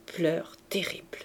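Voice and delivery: usual speaking voice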